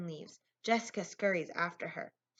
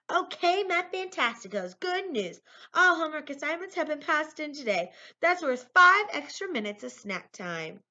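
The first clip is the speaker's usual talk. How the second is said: high, loud cheerleading teacher; rally the class with good news; big, bouncy teacher's proclamation; upbeat announcement; 9 semitones higher, 8 dB louder than usual. A narrator's reading.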